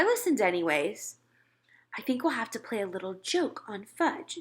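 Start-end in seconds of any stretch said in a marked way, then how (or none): 2.0-4.4 soft plotting whisper; mother whispering the plan. A narrator's reading. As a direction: whisper the scheme. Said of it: "she said".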